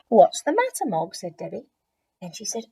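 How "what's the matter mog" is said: put-on concerned child. Debbie worried.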